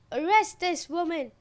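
high, very loud frantic order; shriek. A narrator's reading. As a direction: shriek a command to the guards. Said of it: "Matanaria shrieked".

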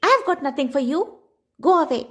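put-on high, curt old woman brushing him off; grumpy old woman. A narrator's reading. Old woman sends the traveller away, cranky and high.

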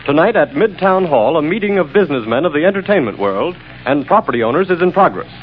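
tinny newscaster over the radio; radio broadcast. Acted. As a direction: read the bulletin like a radio news feed. Radio news bulletin.

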